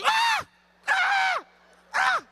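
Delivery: put-on little-girl screams at each chomp; screaming like a little girl. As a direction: shriek like a little girl, three times. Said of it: "I'm screaming like a little girl".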